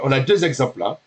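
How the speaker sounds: ordinary delivery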